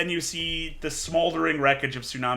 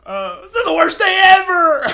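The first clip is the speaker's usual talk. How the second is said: high laughing wail of dismay; laugh-shouting in mock despair; laughing panic; 4 dB louder than usual. Player panics about wrecked mech.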